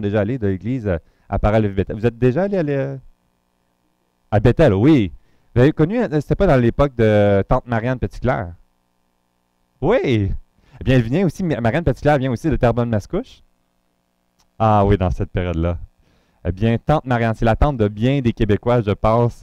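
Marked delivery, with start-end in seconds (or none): none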